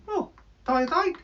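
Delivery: high odd puppet squeak; lightly voiced bird puppet.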